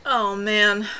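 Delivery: ordinary delivery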